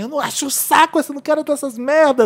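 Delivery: mock childish protest; child refusing. Voicing a child refusing the parents.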